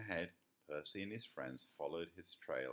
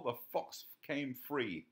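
plain reading voice

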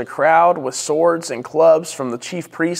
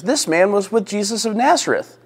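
high-pitched telling on him; accusing servant girl; 7 semitones higher than usual. A narrator's reading. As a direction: announce it to bystanders, voice raised in pitch. Second servant girl tells the bystanders.

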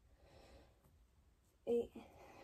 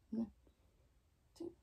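ordinary talk, nothing marked

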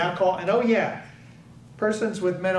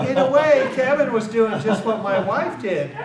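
laughing; 5 semitones higher, 5 dB louder than usual. Real, not acted. Laughing while telling the story.

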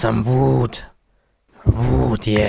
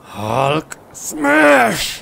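battle cry swooping up high; pause, then throw the last word high; angry Hulk; 8 semitones higher than usual. Acted. Hulk smashing monsters, high angry cry. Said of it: "Hulk is angry".

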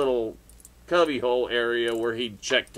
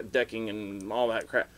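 normal talking voice

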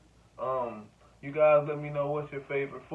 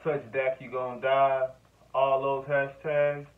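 normal talking voice

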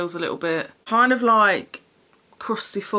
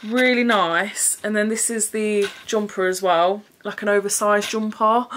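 ordinary delivery

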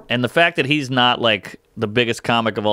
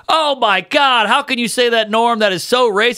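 much higher, scandalized lady voice; shocked radio host; 11 semitones higher, 3 dB louder than usual. Retold or imitated. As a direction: gasp it out like an offended host. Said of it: "the lady is like".

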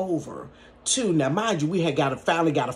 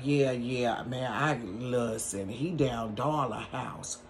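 low, soft, slow man's voice; lightly voiced low-key man on the phone; roommate's voice; 6 semitones lower, 7 dB quieter, slower than usual. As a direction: drop low and lazy like the guy.

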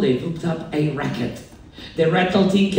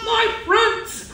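high-pitched joyful cry; excited cry. A narrator's reading. Elephant finding his friends at last, crying out. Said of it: "cried the elephant".

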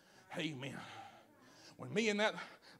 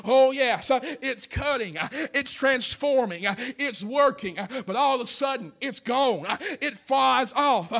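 high, loud, drawn-out preacher's cry; preacher climaxing the axe-head story; shouted preaching; 8 semitones higher, 12 dB louder, slower than usual. Real, not acted. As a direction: stretch each phrase out at full force.

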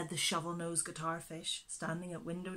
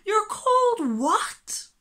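high, slow, loud incredulous cry; disbelief; 14 dB louder, slower than usual. A narrator's reading. Animal hearing the wobbegong's name, loud high disbelief. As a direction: gasp out the question in disbelief.

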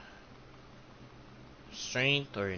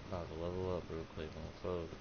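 fast mutter; 5 semitones lower, 14 dB quieter, faster than usual. Real, not acted. Muttering to himself while leveling.

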